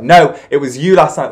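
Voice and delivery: loud, indignant denial; mum denying. Retold or imitated. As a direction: protest it wasn't her. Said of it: "She's like".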